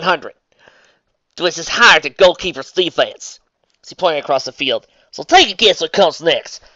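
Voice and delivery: put-on country girl calling her move; Southern accent. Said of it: "doing a Southern voice for a duel".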